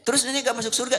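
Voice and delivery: put-on high, fast, fretful old woman's voice; squeaky worried grandma; anxious grandmother. Grandmother pleads.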